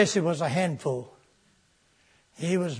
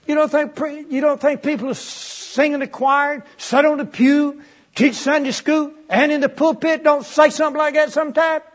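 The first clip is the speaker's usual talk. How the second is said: preaching shout; 7 semitones higher, 5 dB louder than usual. Real, not acted. Preacher shouting at congregation, loud and high. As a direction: hammer the question at the congregation.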